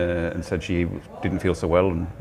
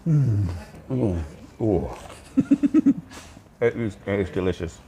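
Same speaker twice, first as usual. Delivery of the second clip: talking while chewing; words round a mouthful of food; mouth full. Real, not acted. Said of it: "I apologise for talking with my mouth full".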